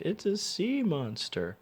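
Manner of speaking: high, slow, horrified wail; lady crying out at a sea monster; terrified cry. A narrator's reading. Frightened lady at the beach.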